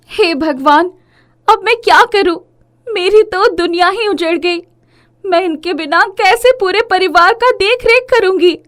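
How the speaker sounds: high, anguished acted wail; grief-stricken, pitched high and loud; distraught widow's lament. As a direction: cry out to God in grief. Widow laments her husband's death.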